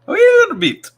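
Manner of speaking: loud, very high cartoon Irish; hammed-up Irish falsetto, pushed loud; mock Irish accent. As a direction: squeal it out in comic Irish. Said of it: "I like Irish accents".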